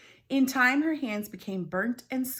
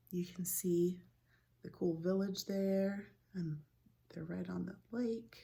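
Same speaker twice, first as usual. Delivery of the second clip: whispered aside; 6 dB quieter than usual. Real, not acted. Reader whispering to children about the picture.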